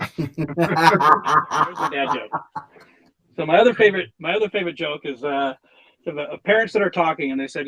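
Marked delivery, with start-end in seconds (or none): 0.0-2.3 said through a slow laugh. Real, not acted. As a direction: chuckle the words out after the punchline. Laughing at own punchline.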